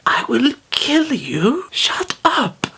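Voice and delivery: high, slow, breathy whispered threat; hissed inner threat. Retold or imitated. Teen silently threatening the classmate who outed him, whispered menace.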